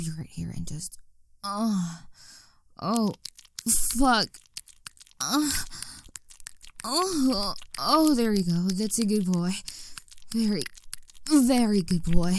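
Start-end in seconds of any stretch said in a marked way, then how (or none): 1.4-12.3 aroused cries into praise; building aroused moans. Acted. Climax building, louder moans.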